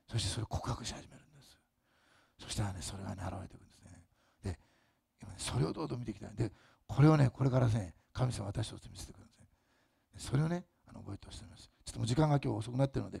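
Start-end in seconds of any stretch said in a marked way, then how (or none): 0.1-11.7 hushed sermon. Acted. Sermon winding down softly.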